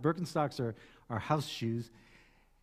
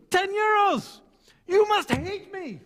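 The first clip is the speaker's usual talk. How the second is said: loud, high outburst of mock outrage; excited haggler; 5 dB louder, slower than usual. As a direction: wildly overblown wounded merchant cry. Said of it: "He gets so excited".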